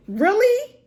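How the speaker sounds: high, rising incredulous question; mock-incredulous high squeal; retold disbelief. Acts out own disbelieving reaction.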